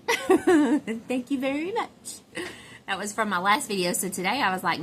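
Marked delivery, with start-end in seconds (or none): 0.1-1.9 higher, louder, drawn-out giggly thanks; laughing thanks. Real, not acted. Thanking a commenter for makeup compliment, giggling. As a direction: laugh your way slowly through it.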